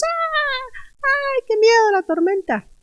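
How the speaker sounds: very high, thin mock scream; hammed-up frightened shriek. Preacher mocking the disciples panicking in the storm, shrieking like mad. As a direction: squeal in exaggerated panic, without real force. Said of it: "como los discípulos a gritar".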